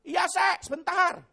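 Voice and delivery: loud, high shout back; imitated husband's yell. Husband shouts from the bedroom to his wife, imitated yell. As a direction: holler it from another room. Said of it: "suaminya teriak".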